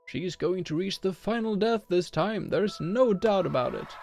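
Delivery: loud, high hyped arena announcer. Acted. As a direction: pump up the crowd like a ring announcer.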